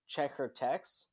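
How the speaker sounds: slow flat deadpan final words. Retold or imitated. Podcaster quoting a dying character's last line, slow. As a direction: drag the line out flatly. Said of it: "she says".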